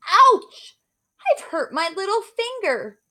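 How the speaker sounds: high, loud yelp of pain; shouted ouch. Acted. Beauty pricking her finger on the needle, high loud yelp. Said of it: "and then I shouted".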